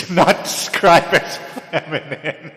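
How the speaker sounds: breaking into real laughter mid-sentence; can't hold back a laugh at herself. Real, not acted.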